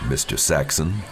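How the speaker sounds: a touch of oily insincerity; slimy, disingenuous. A narrator's reading. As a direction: let the name roll off the tongue. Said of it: "slimy and disingenuous".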